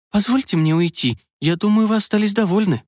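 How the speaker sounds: put-on a young woman, a bit annoyed. A narrator's reading. Young woman asks to leave.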